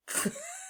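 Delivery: high little giggle; amused squeaky giggle. Real, not acted. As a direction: break into a quick high laugh. Laughs after tongue exercise.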